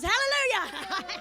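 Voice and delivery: high, drawn-out church cry with a laugh; mock praise. Comic celebrating the prize, laughing hallelujah cry. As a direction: sing out praise, breaking into laughter.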